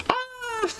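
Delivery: thin, high-pitched squeal of nerves; nervous squeal. Real, not acted. Streamer freaking out before viewers, nervous squeal. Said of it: "I'm just so nervous".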